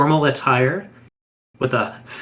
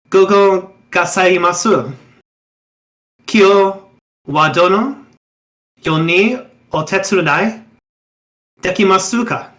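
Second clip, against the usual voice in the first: eager, raised and louder, Japanese words pressed out warmly; a young wraith fervently greets a traveler at the tea house door; fervent Japanese greeting; 4 semitones higher, 3 dB louder than usual. Acted. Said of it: "He will say this quite fervently".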